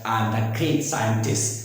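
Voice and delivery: usual speaking voice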